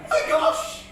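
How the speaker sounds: very high comic gasp of alarm; mock fright. Woman recognising Sam in the poem, high hammed-up fright. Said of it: "Her voice was full of fright".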